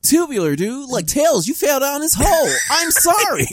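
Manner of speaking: high excitable surfer dude; hammed-up radical cartoon hero. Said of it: "just sound radical".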